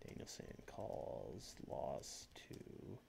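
low, slow whisper; whispered headline. Pretend. Reading a fake news headline, slow whisper. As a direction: breathe the headline out like a secret.

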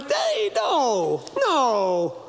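high, squeaky hey no no; mock protest. Preacher mocks action movie, high squeaky protest. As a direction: object in a silly high voice. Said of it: "And I'm like".

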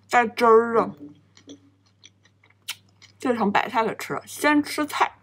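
speaking between bites; talking while chewing. Real, not acted. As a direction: mouth full of bread. Seen 0: Bites sandwich and chews; food visible in mouth while talking.

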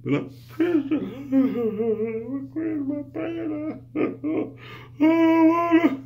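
squeaky high put-on voice, laughing through it; mock churchgoer voice. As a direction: play a whiny believer protesting, very high. Voicing an objecting churchgoer.

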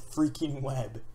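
ordinary delivery

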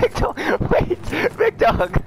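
shrieking very high in laughing panic; panicked high screaming. Real, not acted. Gamer screaming as the big dog attacks, panicked.

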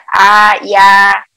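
loud, clearly sounded "A, ya" as a drilled Korean vowel pair. Shown as a demonstration. Teacher calling out Korean vowels for the learner, loud and deliberate. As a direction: call out "A, ya" like a teacher drilling the class. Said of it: "teaching the Korean vowel sounds".

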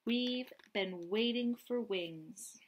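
ordinary talk

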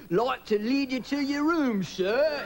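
slow, high, exaggerated British porter accent; British hotel porter. Hotel porter greets a guest, exaggerated British accent. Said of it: "I'm really in tune with voices, and I thought people were putting me on. I mean, the guy at the hotel's like".

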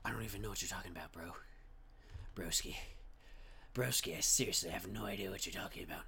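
a low, fast put-on voice; a lightly low put-on bro voice. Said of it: "if I keep talking in this voice".

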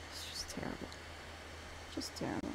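a breathy whispered grumble; whispered complaint. Real, not acted. Player whispering to himself that the route is terrible. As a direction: whisper it, half to yourself. Muttering about the route aloud.

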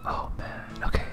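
ordinary talk

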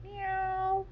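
high, drawn-out meow; cat meow. Acted. Imitating the cat.

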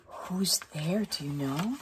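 soft curious whisper. Real, not acted. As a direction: ask it under your breath. Hushed guess to listener.

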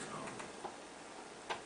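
very soft, whispered single word; barely audible, gentle beckoning; hushed call. Acted. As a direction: whisper it as a quiet invitation. Voicing Jesus's call, hushed.

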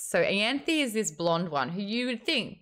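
ordinary talk, nothing marked

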